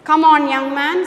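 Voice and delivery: severe command. A narrator's reading. Prospero commanding the young prince, severe. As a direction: order him briskly and severely. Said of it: "Prospero commands with a severe tone".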